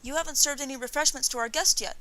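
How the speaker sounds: put-on high, loud boy's voice; insistent boy. A narrator's reading. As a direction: pipe up like a demanding child. Boy complaining to his mother.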